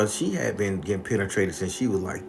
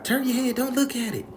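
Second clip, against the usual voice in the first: put-on high old-lady scold; shrill grandma voice ordering him to look away. Grandma scolding grandson, high-pitched old lady voice. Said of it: "Grandma made me cover my eye".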